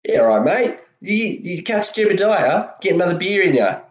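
really Aussie it up, pitch climbing; ocker Aussie accent. Shown as a demonstration. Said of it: "like, a really ochre, like, Aussie".

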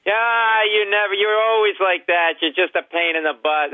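mock-angry high complaint, easing down; imitating family bickering. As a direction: nag like a fed-up relative, starting high. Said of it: "Everybody was mad the whole time and yelling".

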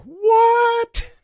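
shrieked high-pitched what; retold scream. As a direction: replay the shocked scream at the screen. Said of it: "started screaming at each other".

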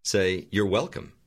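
ordinary delivery

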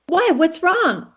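worried husband, imitated. Wife imitates husband reacting to her fine, worried. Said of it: "And he'll go".

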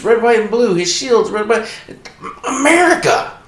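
hammed-up patriotic yell; patriotic shout. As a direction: belt it like a rally cheer. Gushing patriotic outburst.

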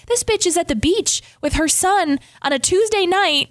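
high, mock-outraged envy; mock jealous outburst. Friend scrolling Instagram, playfully envious and mock-outraged. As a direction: act scandalised at a friend's beach pics. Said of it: "I open your Instagram and I'm like".

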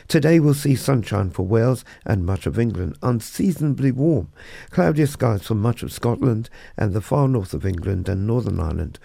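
crisp radio newsreader delivery; news read. Acted. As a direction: read it like a bulletin. Weather and briefing read.

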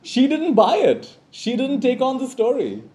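high, slow delighted laugh in the voice. Real, not acted. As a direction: still laughing at her cheek. Laughing at daughter's reply.

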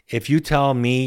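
ordinary talk, nothing marked

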